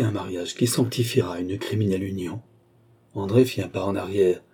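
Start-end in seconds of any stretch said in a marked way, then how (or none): none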